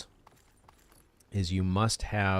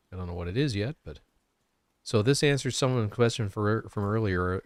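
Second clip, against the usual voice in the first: ordinary talk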